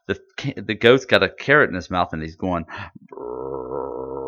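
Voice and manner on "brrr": low, drawn-out goat bleat; slowed goat bleat. Retold or imitated. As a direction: imitate a goat in slow motion. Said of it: "it's slowed way down".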